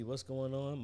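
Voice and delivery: usual speaking voice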